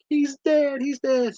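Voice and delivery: high-pitched little wail; hammed-up high lament; mock crying kid. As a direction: imitate a tearful child in distress. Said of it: "he's crying his tears out".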